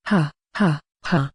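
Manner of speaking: awkward fake little laugh; forced chuckle. Nervous elf forcing an awkward chuckle at the orc's boast. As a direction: chuckle politely to dodge the moment. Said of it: "He forces a chuckle".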